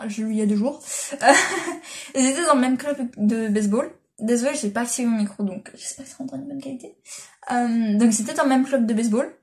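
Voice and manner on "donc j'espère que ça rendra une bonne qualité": whispered aside; murmured apology about the mic. Real, not acted. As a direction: breathe it out, no voice. Mic not activated, whispered aside.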